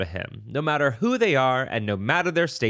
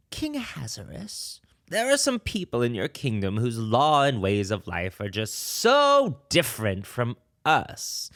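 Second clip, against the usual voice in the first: villain voice; 4 semitones higher than usual. Acted. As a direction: wheedle the king like a sly villain. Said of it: "I'm going to try to do my best villain voice here".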